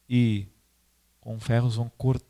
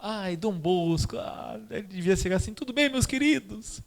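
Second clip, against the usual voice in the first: sugary Dom Bosco; 7 semitones higher than usual. Retold or imitated. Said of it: "O pessoal fala".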